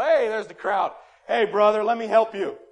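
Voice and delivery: loud, hearty hail to a brother; showy calling out. Acted. Show-off giver hails a poor man before a crowd, calling out loudly. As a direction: call out so the whole crowd hears.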